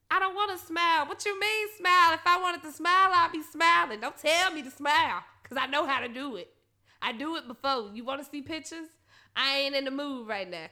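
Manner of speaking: loud, fed-up rant at a stranger; angry rant. Retold or imitated. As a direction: snap back at him, loud and sick of it. Ranting at a man who said smile.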